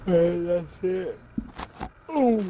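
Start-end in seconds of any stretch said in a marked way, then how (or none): 2.1-2.4 excited ooh. Real, not acted. Reacting in game.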